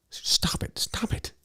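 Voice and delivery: urgent whispered stop it; hissed warning. Retold or imitated. Girlfriend grabbing his elbow, urgent whisper. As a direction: whisper it sharply to shush him. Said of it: "she's like".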